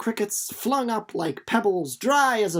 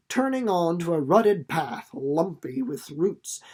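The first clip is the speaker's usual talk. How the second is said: plain reading voice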